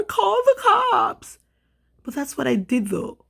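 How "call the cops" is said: high, slow, breaking into a cry; whiny mock advice. Parroting the advice as her voice cracks with tears. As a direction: stretch it out, voice crumpling.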